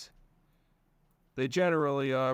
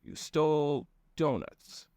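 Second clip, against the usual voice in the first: slow, dry disbelieving repeat; deadpan it back at him; sarcastic; 3 semitones higher, slower than usual. Acted. Narrator mocking his cousin's excuse, sarcastic and slow. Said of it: "I replied sarcastically".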